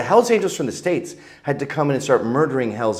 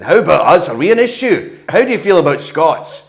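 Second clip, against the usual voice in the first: loud, high mock Scottish challenge; Scottish dad; 8 semitones higher, 8 dB louder than usual. Dad confronting snobbish neighbours, loud Scottish accent. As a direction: burst out like an affronted Scottish father. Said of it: "And my dad said".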